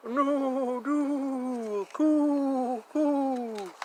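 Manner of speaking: high hooting bird call; playful high cooing; pigeon coo. Pretend. Imitating bird calls in rhyme.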